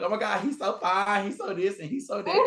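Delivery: high, breathless fangirl gushing; gushing teen. Retold or imitated. Girl gushing over him to her mom, high voice. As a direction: gush like a swooning teen.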